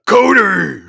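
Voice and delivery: loud, high angry yell of a name; furious shout. Retold or imitated. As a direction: scream the name in rage. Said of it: "He's like".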